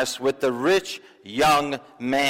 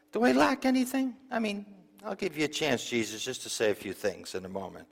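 falsely humble; 8 dB quieter than usual. Acted. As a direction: feign humility in a small, hushed voice. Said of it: "just to appear humble".

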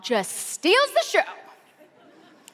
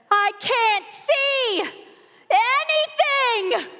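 high, slow, loud bewildered shout; a dazed groomsman yelling to the church; 11 semitones higher, 3 dB louder, much slower than usual. Retold or imitated. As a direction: bellow it out blindly like the groomsman. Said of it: "because you are shouting".

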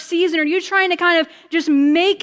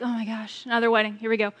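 bored sigh; 6 semitones lower, 7 dB quieter, faster than usual. Retold or imitated. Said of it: "you begin to be like".

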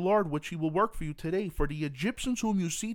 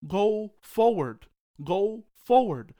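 loud, slow preaching command; booming slow command; commanding shout; 5 semitones higher, 7 dB louder, much slower than usual. Acted. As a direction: thunder it out as God.

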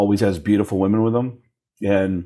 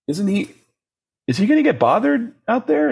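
worried question; 11 semitones higher than usual. Retold or imitated.

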